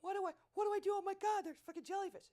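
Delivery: high, loud terrified shriek; panicked scream. Retold or imitated. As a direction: shriek in a panic mid-air. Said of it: "I'm screaming at the guy".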